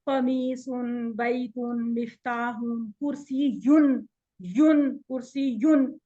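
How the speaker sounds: very slow syllables, every -un sounded; drilled endings. Shown as a demonstration. Teacher drills endings for the students, drilled endings. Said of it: "इसके ऊपर आपने थोड़ा सा ध्यान रखना है".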